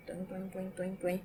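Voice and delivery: soft springy tuing noises; cartoon boing sound for an active adjective; springy sound effect.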